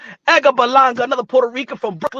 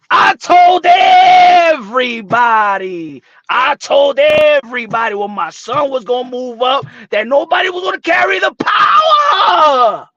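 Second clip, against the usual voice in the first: mock tearful yell; 6 dB louder than usual. Father insisting he predicted the power, loud choked yell. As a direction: yell it like he is near tears.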